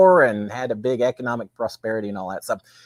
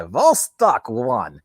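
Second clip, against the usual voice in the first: slow mock Russian accent on Vostok one; 3 semitones lower, much slower than usual. Shown as a demonstration. Joking about vodka, saying Vostok one in a Russian accent. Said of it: "You can say it like a Russian accent".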